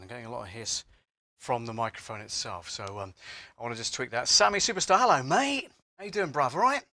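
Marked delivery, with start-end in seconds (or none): 4.3-6.8 excited greeting. Real, not acted. Greeting a live viewer.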